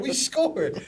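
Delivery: high-pitched replay of sideline shock; retold excitement. Player retelling the fourth-down play to teammates, high-pitched excitement.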